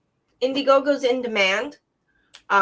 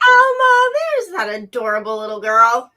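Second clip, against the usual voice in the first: excited shout; 3 semitones higher, 8 dB louder than usual. Real, not acted. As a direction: call out her name with delight. Greeting a child on stream.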